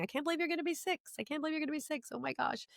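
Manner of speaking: high, soft sing-song gushing; gushing to grandson. Retold or imitated. Grandmother marvelling to her grandson about turning six, high cooing sing-song. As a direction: coo it like doting over a little kid.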